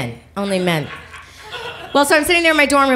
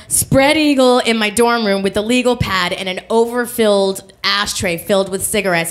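ordinary talk

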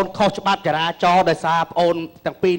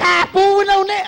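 much higher, louder retort; indignant protest; 12 semitones higher, 5 dB louder, faster than usual. Acted. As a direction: push back hard against the accusation. Policeman protests accusation. (ប៉ូលីសតវ៉ាខ្លាំងៗ សំឡេងខ្ពស់)

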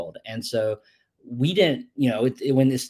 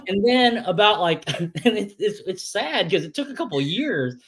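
ordinary delivery

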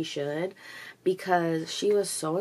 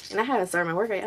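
ordinary talk

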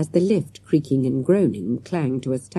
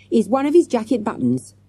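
higher-pitched, excited reveal; 7 semitones higher than usual. A narrator's reading. Boy revealing the waiter's button, excited. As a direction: land the clue with excitement. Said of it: "he added excitedly".

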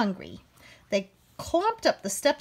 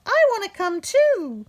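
higher, louder call; 9 semitones higher, 5 dB louder than usual. Acted. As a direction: keep calling after them. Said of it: "she called".

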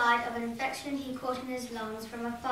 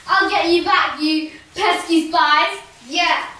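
loud, slow vengeful shout; villain threat; 3 semitones higher, 12 dB louder than usual. Acted. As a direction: vow revenge like a villain.

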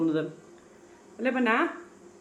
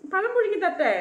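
put-on baby talk; 10 semitones higher than usual. Mother asks baby.

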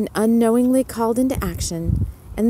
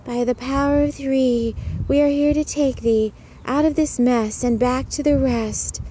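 higher, chanted rhyming spell; measured sing-song, like children casting a spell; sing-song incantation; 4 semitones higher than usual. A narrator's reading. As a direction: chant the spell in an even lilt. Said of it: "incanted together in sing-song".

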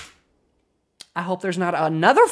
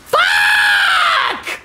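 frustrated shout. Real, not acted. Streamer losing rock paper scissors, frustrated shout. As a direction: yell it as you lose.

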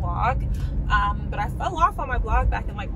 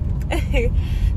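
breathy burst of laughter; quick unvoiced giggle; airy laugh; 4 semitones higher, 7 dB louder than usual. Real, not acted. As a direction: laugh it off at yourself. Laughs after the greeting.

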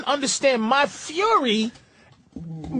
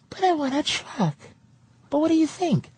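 a hint of a pleading girlfriend, a little higher; girlfriend's voice; 5 semitones higher than usual. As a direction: lightly voice her, whiny and indecisive. Voicing his woman in story.

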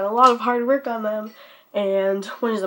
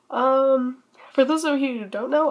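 ordinary talk

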